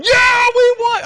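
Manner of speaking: very high, loud celebrating shout; acted-out fan cheer at full volume; retold victory yell. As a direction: whoop it out, stretching the words. Acting out own celebration at game.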